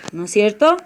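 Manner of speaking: ordinary delivery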